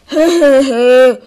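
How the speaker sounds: loud villainous cackle; evil laugh. Acted. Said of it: "Ihr Lachen stieg zu einem lauten, schallenden Geräusch".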